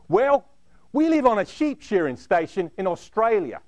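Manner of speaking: put-on a cheery Aussie rugby player explaining in an Australian accent. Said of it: "they had Australian accents".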